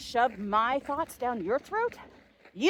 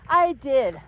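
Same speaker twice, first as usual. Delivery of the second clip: usual speaking voice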